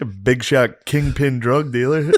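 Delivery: slow teasing line dissolving into a high laugh; laughing tease. Real, not acted. Friend teasing the groom about dealing, laughing. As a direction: crack up while teasing him.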